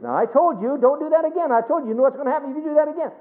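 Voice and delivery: mock scolding parent. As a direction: ham up a parent losing patience. Mimics a parent scolding a child.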